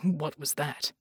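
low rumbling character voice; low rumble. A narrator's reading. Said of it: "he rumbled".

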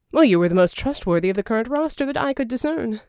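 a smooth villain tossing off an answer; Verdigris voiced. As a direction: rattle it off glibly.

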